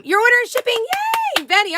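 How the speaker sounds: high, loud, delighted; excited cheer. Real, not acted. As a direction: celebrate the order with glee. Cheering a viewer's order.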